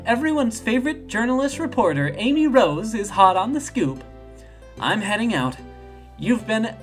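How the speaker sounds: high, self-promoting reporter voice; perky reporter Amy. Acted.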